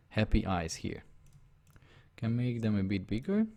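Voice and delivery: ordinary talk, nothing marked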